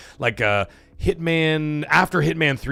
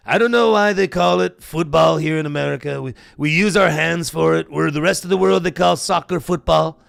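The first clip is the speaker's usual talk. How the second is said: Trump impression; 3 semitones higher than usual. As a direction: do your best Trump, mid-rant on football. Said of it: "I'm trying to do my best Trump impression".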